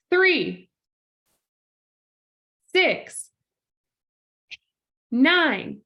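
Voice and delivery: called out multiples. Shown as a demonstration. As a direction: call out every third count. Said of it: "we whisper count".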